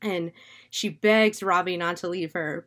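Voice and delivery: ordinary delivery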